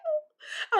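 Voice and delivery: high squeal through a laugh; a laughing, crying yelp of dread; mock panic breaking into a laugh. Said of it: "I'm like".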